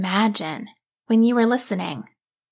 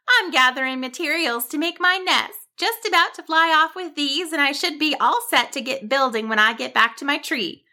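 higher, perky little bird voice; chirpy bird; 6 semitones higher than usual. Acted. Bird explains her nest building to Cassandra, chirpy. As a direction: chatter busily as a cheerful bird.

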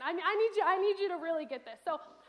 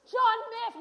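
put-on dramatic child line; 7 semitones higher, 8 dB louder than usual. Child acting John Smith line.

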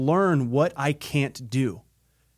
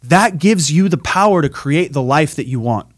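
loud, driving stage-speaker delivery; big keynote voice, every phrase pushed out loud; motivational preaching; 8 dB louder than usual. Acted. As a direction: preach it to the whole arena at full force.

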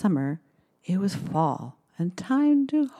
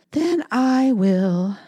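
slow, louder firm hen; determined hen; 4 semitones higher, 4 dB louder, slower than usual. Acted. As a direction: say it firmly, on her own. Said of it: "said the little red hen".

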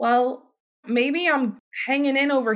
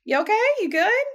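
breezy hallway greeting; 12 semitones higher than usual. Retold or imitated. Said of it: "type thing".

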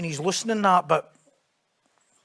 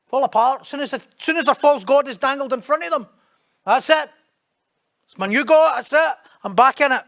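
louder, higher preaching burst; preaching at full force; 5 semitones higher, 5 dB louder than usual. Acted. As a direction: hammer it home to the congregation.

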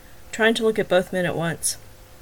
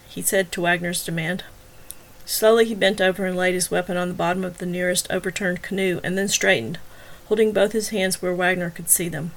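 plain reading voice

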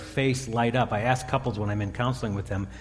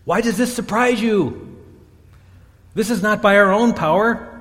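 shouting out; 9 semitones higher, 7 dB louder than usual. Retold or imitated. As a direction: shout it out over the stunned crowd. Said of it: "And somebody shouted out".

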